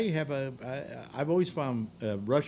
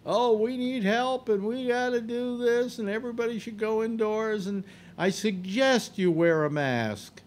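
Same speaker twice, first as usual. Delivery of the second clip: mocking imitation of the governor; 9 semitones higher than usual. Mocking the governor's sudden reversal.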